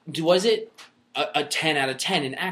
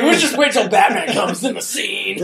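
mocking quote; 5 semitones higher, 7 dB louder than usual. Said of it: "You're like".

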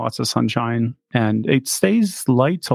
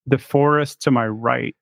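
ordinary talk

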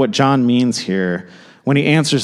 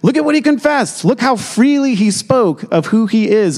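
emphatic; 8 semitones higher than usual. Real, not acted. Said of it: "he says it with this emphasis".

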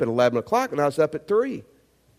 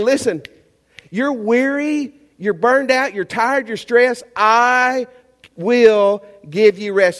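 loud, higher, slow pulpit-banging plea; pounding-pulpit preaching; 5 semitones higher, 5 dB louder, slower than usual. Acted. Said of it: "he was banging on it and he was saying".

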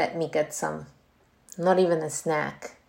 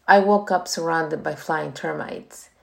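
usual speaking voice

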